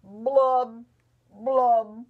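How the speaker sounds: slow, loud, droopy fish voice with mopey blubs; sing-song glum fish, dragging every word; gloomy pout fish. Acted. Mother reading to daughter as the glum fish. Fish's refrain again.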